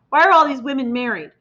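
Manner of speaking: plain reading voice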